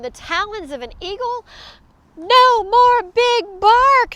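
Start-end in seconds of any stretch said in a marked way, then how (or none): 2.2-4.1 slow, high, loud crowd cheer. A narrator's reading. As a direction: celebrate in a drawn-out shout. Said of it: "the crowd cheered".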